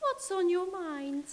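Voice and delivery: a prim lady answering the phone. Acted.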